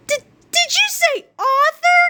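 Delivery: stammering, high-pitched excitement; excited cry. A narrator's reading. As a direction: blurt it out, tripping over the first word. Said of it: "cried George excitedly".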